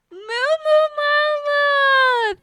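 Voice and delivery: drawn-out excited greeting; calling out. Real, not acted. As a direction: sing out the name in a long happy call.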